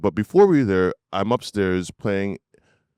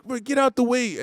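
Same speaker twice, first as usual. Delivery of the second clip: higher, hurried mock order; urgent dad; 12 semitones higher than usual. Dad heading to the fire, urgent brush-aside. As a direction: imitate a father rushing past. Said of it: "my dad was like".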